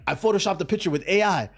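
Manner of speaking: proud, high-pitched Uber driver in a mock accent; random foreign accent. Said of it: "just doing a random accent".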